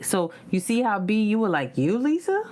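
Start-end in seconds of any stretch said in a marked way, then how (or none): 1.8-2.4 mock disbelief. People doubting Lisa's fiery side, incredulous. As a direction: voice climbing high on the name.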